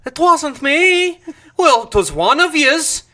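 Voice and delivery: put-on high, slow Scottish accent; imitating little Scottish girls protesting. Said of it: "both girls are like".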